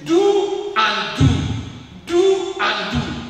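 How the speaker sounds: slow, loud, each do hammered; double emphasis. Shown as a demonstration. Preacher stressing the doubled words to the church, slow and loud. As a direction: pound out "Do and do" slowly. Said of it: "Double emphasis".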